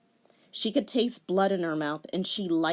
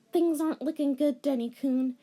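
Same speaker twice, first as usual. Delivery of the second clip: sighing; 8 semitones higher than usual. Acted. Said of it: "she sighed".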